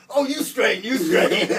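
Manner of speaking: loud, high mimicry through laughter; laughing mockery. Teller returns the inmate's reassurance after his sentence, laughing loud mimicry. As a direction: crack up while throwing his own words back at him.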